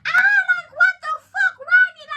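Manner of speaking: high, loud, shrieking half-laugh; screaming ladies. Retold or imitated. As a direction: shriek it like the shocked ladies at the bar. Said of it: "girl, we screaming".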